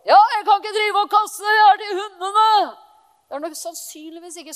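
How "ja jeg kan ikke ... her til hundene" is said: loud, high, angry rebuke; harsh shout. Pretend. Jesus harshly turning the woman away: angry shout. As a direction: bark it at her like a harsh refusal. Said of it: "Det er ikke litt sånn".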